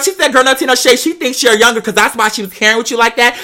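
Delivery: ordinary talk, nothing marked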